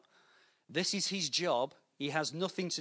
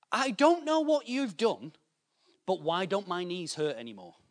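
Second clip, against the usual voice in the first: put-on the old neighbour bursting out in wonder; amazed pensioner; 4 semitones higher, 5 dB louder than usual.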